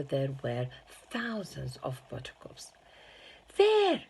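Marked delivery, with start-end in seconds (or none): 3.6-4.0 a high, loud burst of delight; excited cry. A narrator's reading. Wonka showing the children his room, a high loud cry. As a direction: shout it out, pointing with glee. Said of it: "cried Mister Wonka".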